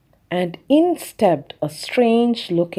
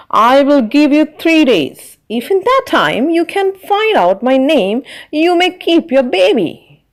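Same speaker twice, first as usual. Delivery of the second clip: high, louder impish little man; little man's voice; 6 semitones higher, 4 dB louder than usual. A narrator's reading. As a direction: tease her like a sly little imp. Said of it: "He said".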